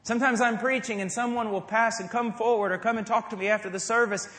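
ordinary delivery

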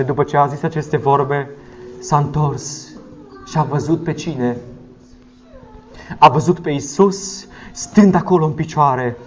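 usual speaking voice

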